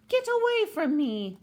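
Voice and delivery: high, loud cry of a startled woman; shrill alarmed neighbor. Acted. Startled neighbor shouting at the penguin, high and loud. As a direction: shoo the bird away in a high fright. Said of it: "said Missus Callahan to Captain Cook".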